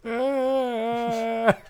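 high, loud laughing ah; laughing sigh. Retold or imitated. Friend acting the show's last scene, laughing hard. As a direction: dissolve into laughter. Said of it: "And he's just like".

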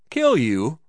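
high, loud, incredulous question; exclaiming. A narrator's reading. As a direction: exclaim it in disbelief. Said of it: "ejaculated Scully".

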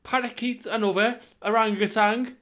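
high, loud, giddy plan; excited. Real, not acted. Excited zoo menu.

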